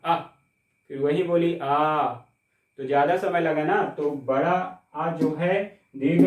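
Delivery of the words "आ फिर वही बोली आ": आ held long and slow. Shown as a demonstration. Teacher showing students how long आ takes, drawn-out आ. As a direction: stretch आ to show a long vowel. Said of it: "आप बोल के देखिए ना".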